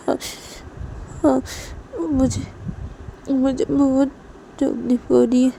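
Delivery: wounded and haltingly insistent; hurt admission. Acted. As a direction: admit the pain in broken phrases. Hurt character answers in story.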